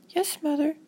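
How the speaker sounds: put-on high-pitched obedient child; high, singsong yes to mom; dutiful little girl. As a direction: agree meekly as a small girl. Girl dutifully agrees with mother.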